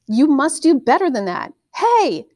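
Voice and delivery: high, loud yell building to Hey; girl yelling at the hog. A narrator's reading. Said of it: "she yelled".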